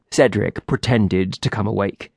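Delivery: ordinary delivery, nothing marked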